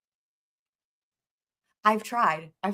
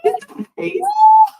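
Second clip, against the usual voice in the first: crying-laughing shriek; wheezing high laugh; 7 dB louder than usual. Real, not acted. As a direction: laugh until you cry. Helpless laughter at the clip.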